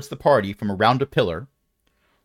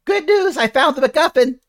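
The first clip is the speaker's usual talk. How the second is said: pitched way up, loud and pleased; high excited NPC voice; 6 dB louder than usual. Acted. GM voicing an NPC who echoes through the chamber, loud and high. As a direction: announce the find brightly, high and loud. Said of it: "you hear a voice say".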